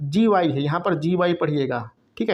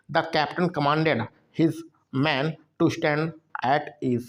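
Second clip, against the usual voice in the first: slow, deliberate English reading; very slow classroom reading; slow dictation read; much slower than usual. Shown as a demonstration. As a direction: read it word by word for learners. Teacher reads answer sentence.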